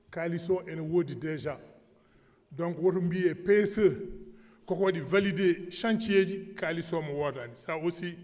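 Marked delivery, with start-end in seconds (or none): 2.5-6.5 louder and higher, pressing the point; emphatic loud. Real, not acted. Heated point on funding.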